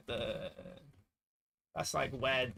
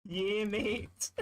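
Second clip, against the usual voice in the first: mock northern English voice, pitched up; ratty northern accent; 6 semitones higher than usual. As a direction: mimic a ratty northern lad, higher. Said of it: "that's such a ratty northern".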